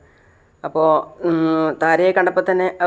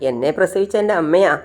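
plain reading voice

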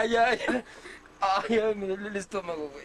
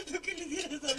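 laughing; 6 semitones higher, 7 dB quieter, faster than usual. Real, not acted. Laughing again at friend.

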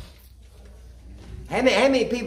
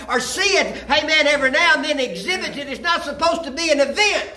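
preaching at full force; 6 semitones higher than usual. Acted. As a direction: drive it home like a revival sermon.

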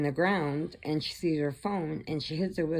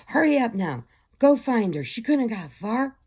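raised, higher voice giving orders; acted urgent order; 7 semitones higher, 5 dB louder than usual. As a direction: send them off urgently, louder and higher. Acted boss ordering a search.

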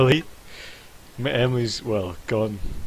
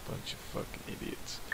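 breathy muttered insult; whispered; 17 dB quieter than usual. Real, not acted. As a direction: hiss it under your breath.

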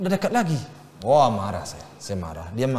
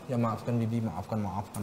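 low, hushed plea for forgiveness; soft retold apology; 7 semitones lower, 10 dB quieter, slower than usual. Child asks parents' forgiveness while hugging, soft imitated apology.

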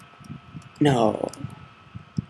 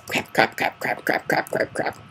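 cartoon character; 5 dB quieter than usual. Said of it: "an old cartoon character who used to go".

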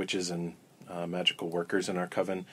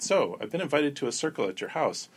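retold line, higher and louder, especially the date; eager announcement; 7 semitones higher, 8 dB louder than usual. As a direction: proudly announce the invitation, building on the date. Telling hosts about the invite.

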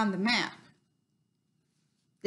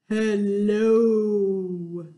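low, louder, dragged out hello; 4 semitones lower, 5 dB louder than usual. Shown as a demonstration. Host says hello as low as she can. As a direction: drop your voice way down low. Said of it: "as low as we can".

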